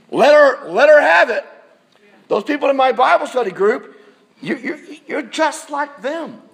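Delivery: elbowing urge. Retold or imitated. Churchgoer nudging a neighbour during the sermon, loud and pushy. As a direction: egg them on, half-joking. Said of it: "you can almost elbow them".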